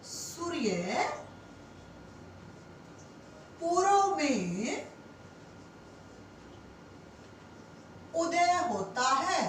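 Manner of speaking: teacher spells out "सूर्य पूर्व में उदय होता है" word by word with long pauses; slow dictated example. Shown as a demonstration. Teacher dictating an example sentence to students, slow and spaced. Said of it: "क्या कह सकते हो".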